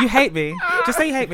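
laughing mock hurt. Real, not acted.

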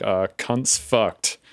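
a friend quotes his Australian host in a slow Australian drawl; deadpan Australian. Retold or imitated. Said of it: "And he was like".

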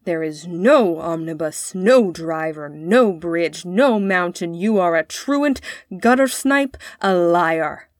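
each accusation landed like a blow; heavy beat on every denial, as a stern father; stern scolding in strokes. A narrator's reading. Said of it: "saying at each stroke".

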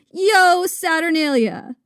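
drawn-out, jolly festive cry; exuberant, super jolly. Acted. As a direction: return the greeting with exuberance. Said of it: "equally exuberant and super jolly".

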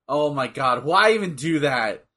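ordinary talk

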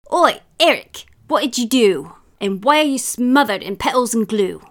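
loud, high-pitched accusing call; accusing little sister. A narrator's reading. Little sister catches Eric in glue, accusing shout. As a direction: confront him as a bossy little sister.

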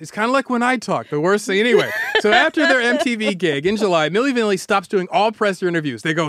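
plain reading voice

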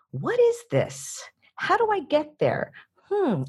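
curious wondering. Shown as a demonstration. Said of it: "what questions and how questions".

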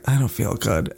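low, feeble mock complaint; sickly whine. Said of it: "she's like".

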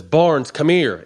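retold cop calling him over.

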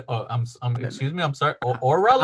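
soft, hesitant mock confusion; confused client. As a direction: stammer politely as the baffled client.

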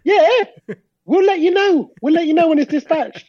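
high, sing-song mock of the shop's brush-off. Mocking the shop's reply.

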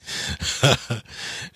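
soft laugh fading out; real amused chuckle; breathy high giggle. Real, not acted. Finding the dollar's backing funny, giggling quietly. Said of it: "That's funny".

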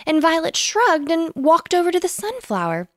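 usual speaking voice

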